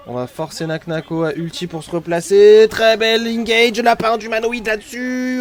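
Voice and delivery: loud, fast hype call; esports caster. Real, not acted. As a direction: shout the teamfight as it happens.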